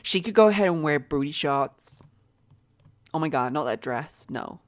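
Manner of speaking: put-on lively American accent, lightly done. Dressing up her game employees, in an American accent. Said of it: "I promise I'm gonna stop now with the accent".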